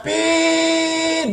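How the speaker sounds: a high, sing-song mock horn toot; sung horn beep. Retelling his wife honking at a reversing car, sung beep. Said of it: "y va".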